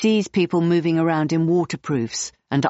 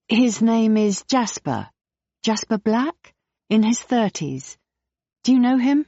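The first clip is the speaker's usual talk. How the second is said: higher, eager questioning; eager Jane; 7 semitones higher than usual. Acted. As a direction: press gently, hopeful for an answer. Jane eagerly asking after Jasper.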